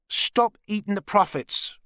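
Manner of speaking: high, slow grumbling wife; a mock complaint, drawn out and high; complaining. A narrator's reading. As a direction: scold him for eating the stock. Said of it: "complained Maggie".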